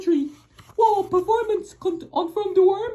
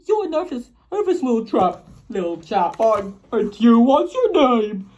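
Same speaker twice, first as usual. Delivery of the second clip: kindly tramp; 8 semitones lower than usual. Acted. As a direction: comfort him like a kind giant. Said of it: "said the tramp gently".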